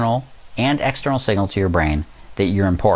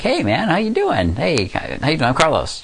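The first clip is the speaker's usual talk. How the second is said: polite friendly; 8 semitones higher than usual. Retold or imitated. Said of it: "I introduced myself politely".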